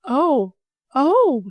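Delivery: a mild gasp of shock. A narrator's reading. Girl sees the dangerous open water, gasping. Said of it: "she gasped".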